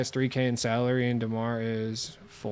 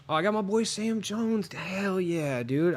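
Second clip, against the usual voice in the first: excited; 8 semitones higher, slower than usual. Real, not acted. Finds a better player.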